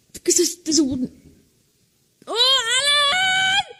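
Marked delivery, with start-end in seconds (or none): 2.3-3.7 1 frightened scream. Real, not acted. Screaming for the guide.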